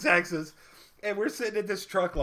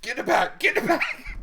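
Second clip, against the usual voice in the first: laughing urgent quote; 10 semitones higher than usual. Retold or imitated. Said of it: "Josh goes".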